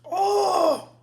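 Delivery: loud high pained shout; sour shout. Real, not acted. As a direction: yell out at the sour burn. Reacting to intense sour candy.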